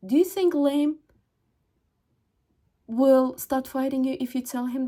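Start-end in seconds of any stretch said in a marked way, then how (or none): none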